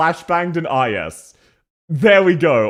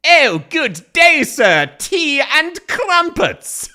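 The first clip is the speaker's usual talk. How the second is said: higher, slow hammed-up posh Brit; mock British accent; 11 semitones higher, 4 dB louder, much slower than usual. Streamer mocking the voice actor's British-sounding Aussie, hammed-up posh Brit. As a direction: ham up a tea-and-crumpets Englishman. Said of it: "This voice I'm about to do".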